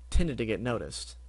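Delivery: ordinary delivery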